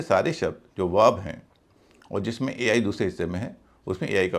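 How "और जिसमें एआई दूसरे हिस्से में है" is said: ordinary talk, nothing marked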